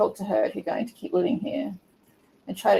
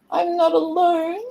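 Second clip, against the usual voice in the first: put-on very high, drawn-out girl voice; hammed-up overcome little cry; tearful happy girl; 11 semitones higher, 5 dB louder, slower than usual. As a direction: squeak it out slowly, high. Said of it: "she's crying 'cause she's happy".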